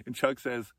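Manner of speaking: usual speaking voice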